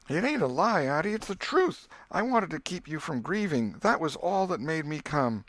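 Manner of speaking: put-on earnest boy swearing. Tom swearing to his aunt he's telling the truth. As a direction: swear it's true, desperate.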